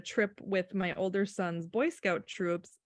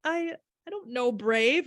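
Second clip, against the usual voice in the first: higher, puzzled mock reply; baffled bystander; 7 semitones higher than usual. Mother retelling a funeral guest's blank response, mock bafflement. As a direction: imitate a baffled onlooker. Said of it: "looking at me like".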